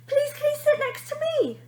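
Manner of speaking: put-on high, eager child voice; a small boy pleading to sit close; little boy's voice. A narrator's reading. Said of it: "a little boy asked".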